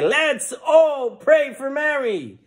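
high, slow revival call; preacher rallying the crowd. Acted. Preacher tells everybody to pray, rallying high and slow. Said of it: "tells everybody".

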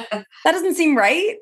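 high, slow incredulous squeak; retold rookie panic, high and slow; mock alarmed. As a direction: stretch it out in mock horror. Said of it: "I was terrified".

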